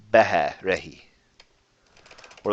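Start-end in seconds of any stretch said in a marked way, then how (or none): 0.1-1.0 behe, rehi with breathy h sounds; breathy h starts. Shown as a demonstration. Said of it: "Yes, it's a short, breathy H".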